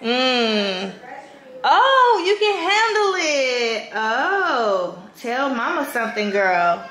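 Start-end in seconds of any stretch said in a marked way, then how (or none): 1.6-3.9 high, slow, delighted encouragement. Real, not acted. Mom cheering baby on while feeding.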